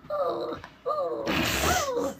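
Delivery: very high imitated dog whimpering; high, soft mock whimpers; dramatic dog whimper. Frenchie with a raw face, dramatic whimpering. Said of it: "he's so dramatic".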